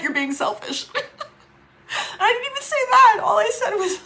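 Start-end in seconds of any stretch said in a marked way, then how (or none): 1.9-4.1 high, louder, quick and tearful; crying. Real, not acted. Accused of abuse she denies, crying. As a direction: push it out through tears.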